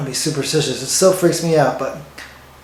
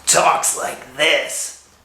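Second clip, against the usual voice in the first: drawn-out, very slow impression voice; slow storyteller impersonation; 2 semitones lower, much slower than usual. Comic mimicking the guy he cites, impersonation dragged very slow. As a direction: stretch every word out long. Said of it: "according to that guy that talks like this".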